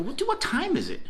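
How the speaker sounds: high-pitched mock disbelief; high, stammered double take; startled question. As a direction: act out being caught off guard, voice jumping high. Acts out his own startled question.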